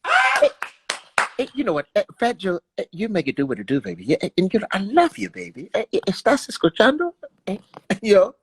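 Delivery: stuttering, excitable musician impression. Comedian showing off for [name], jittery singer impression. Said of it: "You got [name] though".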